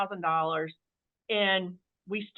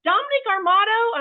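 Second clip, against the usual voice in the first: high, louder shout of a name from afar; yelling across the store; 12 semitones higher, 7 dB louder than usual. Retold or imitated. Somebody in Wall Drug calling out to husband, loud call. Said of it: "somebody's yelling across the Wall Drug".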